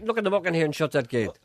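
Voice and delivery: feed the line loud and a bit high; lightly mocked holler; Gregory Campbell. Shown as a demonstration. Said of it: "for Gregory".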